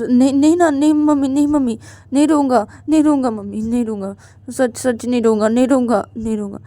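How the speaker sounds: loud, high, rapid-fire promises; urgent, repeated protests, easing slightly at the end; frantic pleading. Acted. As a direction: beg like a desperate child. Child begs, promises not to cry.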